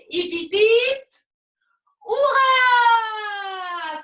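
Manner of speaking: loud high hurrah cheer; cheering shout. Real, not acted. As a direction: lead the crowd in a cheer. Host leads cheer for Charlotte.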